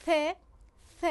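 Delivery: isolated "ث" as a voiceless th; lisped, airy th in "ث"; tongue tip out. Shown as a demonstration. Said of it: "نوک زبان بیرون".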